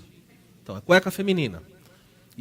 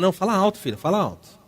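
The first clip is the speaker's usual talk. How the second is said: stern urging; 5 semitones higher than usual. Retold or imitated. Father urges daughter speak up.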